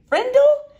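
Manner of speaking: high, loud puzzled echo; kid's startled high "Frindle?"; baffled question. Acted. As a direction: fling the word back in disbelief. Janet baffled by strange word.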